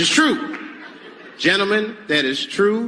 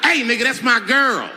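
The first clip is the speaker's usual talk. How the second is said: aggressive, higher and louder; tough butch challenger; 7 semitones higher, 5 dB louder than usual. Retold or imitated. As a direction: step up and claim your girl. Aggressive butch woman confronting.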